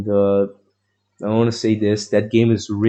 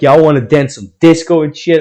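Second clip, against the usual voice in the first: put-on drunken excited shout; 6 semitones higher, 8 dB louder, faster than usual. Drunk dancing in the street.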